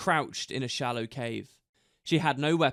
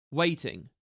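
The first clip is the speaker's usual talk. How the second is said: usual speaking voice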